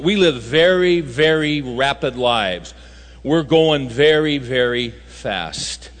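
slow, each "very" stretched out; drawn-out emphasis. Real, not acted. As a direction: drag out "very, very" for weight. Preacher stressing rapid pace.

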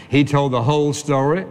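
normal talking voice

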